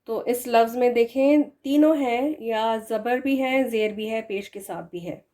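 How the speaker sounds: ordinary delivery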